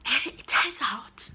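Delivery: ordinary talk, nothing marked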